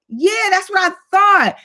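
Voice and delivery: high-pitched, drawn-out defence; mock defensive. Owning up to her partner, acted defensiveness. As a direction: insist on it, slow and high.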